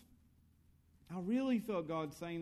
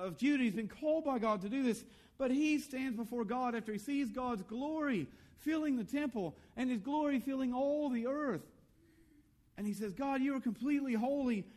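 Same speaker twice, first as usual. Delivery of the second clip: high, raised sermon voice; fervent preaching; 8 semitones higher, 4 dB louder than usual. Real, not acted. Preacher telling of God's glory filling the temple, fervent preaching. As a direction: proclaim the holy vision with driving intensity. Preaches Isaiah's vision with intensity.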